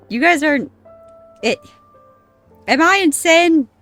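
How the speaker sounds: usual speaking voice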